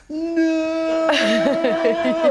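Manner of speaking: high, drawn-out slow-motion wail; hammed-up slow-motion cry of no; mock movie wail as toddler drops the console; slow-motion action film no. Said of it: "like an action film".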